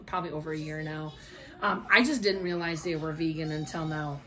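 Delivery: ordinary talk, nothing marked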